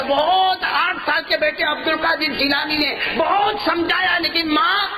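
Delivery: fervent preaching. Acted. Preaching climax.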